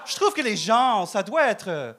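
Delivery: mock Québécois accent. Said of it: "j'ai perdu mon accent".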